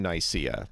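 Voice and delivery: Nicaea said "nigh-SEE-uh"; Anglicised Nicaea, "nigh-SEE-uh"; English pronunciation. Shown as a demonstration. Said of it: "that's not the correct pronunciation".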